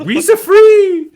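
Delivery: very high, loud, slow squealing Gungan cheer. Retold or imitated. Podcaster imitating celebrating Gungans, squeaky and loud. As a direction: shriek it like a giddy Gungan. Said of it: "celebratory Gungans going like".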